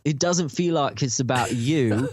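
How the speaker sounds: a lightly faked British accent, laughing through it; mock British accent. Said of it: "talk with a British accent".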